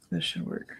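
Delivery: flat, disgusted whisper. Real, not acted. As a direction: mutter it under your breath in a whisper. Whispering during muted trial.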